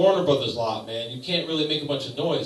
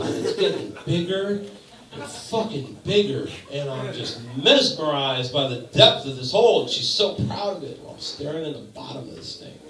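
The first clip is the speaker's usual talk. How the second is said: ordinary talk, nothing marked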